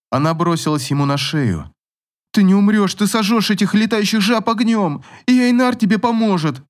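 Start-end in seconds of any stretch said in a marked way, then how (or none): 2.3-6.6 tearful pleading shout. A narrator's reading. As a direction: shout through tears to reassure him. Tearful girl pleads with prince.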